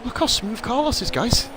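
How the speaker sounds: high, excited race call; excited commentator. Real, not acted. Live commentary, much higher.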